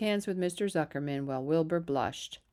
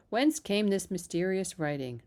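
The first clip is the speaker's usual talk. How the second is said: ordinary delivery, nothing marked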